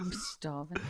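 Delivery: very soft murmur; hushed aside. Real, not acted. Host repeating hunger as topic ends, hushed murmur.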